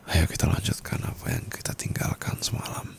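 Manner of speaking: soft whispered invitation; hushed, inviting whisper; seductive whisper. Acted. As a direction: whisper it close to the ear.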